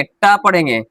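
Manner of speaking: ordinary delivery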